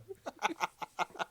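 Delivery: a real high squeal of laughter. Real, not acted. Reading fanfic to a friend, a high squealing laugh. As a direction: burst into a squeaky giggle.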